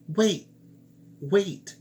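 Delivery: high, loud cry of wait; calling out. Acted. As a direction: shout after her, pitched high. Frog calls after her.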